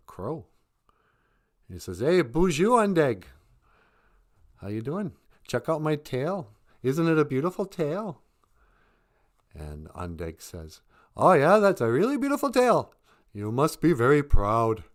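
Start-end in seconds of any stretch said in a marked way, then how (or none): 2.0-8.2 higher, louder cheerful bragging; boastful beaver. Acted. Beaver brags to the crow. 11.1-14.8 much higher, loud bird voice; chirpy crow. Acted. Crow answers the beaver.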